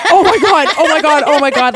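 loud, high, panicky repeats; panicked oh my god. Retold or imitated. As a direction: panic at what you just said. Panicking after the threat.

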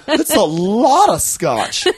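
slow drawn-out high wonder; amazed. Real, not acted. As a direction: savour the amazement. Marvelling at the gift.

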